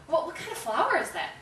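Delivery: high, loud, eager retold question; friendly question. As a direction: act out trying hard to be nice. Third friendly try at the lady.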